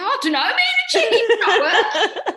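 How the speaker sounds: high, loud, laughing imitation of panic; hammed-up helpless fluster. Pianist telling backstage cast it's not working, flustered.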